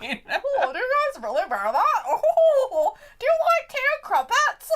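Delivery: high, loud laughing tease. Real, not acted. Host teases the British co-host, laughing high and loud. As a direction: squeal the question through laughter.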